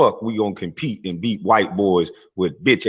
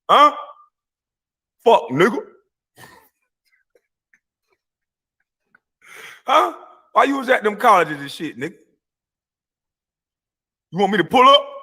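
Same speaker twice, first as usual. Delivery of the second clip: taunting yell; 10 semitones higher than usual. Real, not acted. Taunting a rival host who visited schools, high yelling. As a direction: goad him with a high yell.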